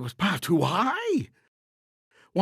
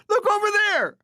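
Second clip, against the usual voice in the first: much higher, loud "Look over there!"; mock diversion cry; 5 dB louder than usual. A narrator's reading. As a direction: point away with a hammed-up cry. Name of the rich people's plan.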